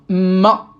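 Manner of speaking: "ma" with the m held long, doubled; shadda intensification. Shown as a demonstration. Teacher showing students the shadda, pressed, doubled m in "ma". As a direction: lean hard on the m of "ma" before releasing the vowel. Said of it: "J'appuie sur la lettre".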